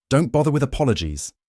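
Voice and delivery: loud, fast, high snap; angry snap. A narrator's reading. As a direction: cut her off sharply. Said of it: "the man snapped".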